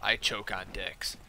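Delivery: low, slow, quiet deadpan; dead serious. Retold or imitated. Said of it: "looked me dead in the eyes".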